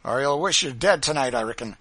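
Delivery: forceful warning; menacing threat. A narrator's reading. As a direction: threaten like a cruel overseer. Driver threatens the woman.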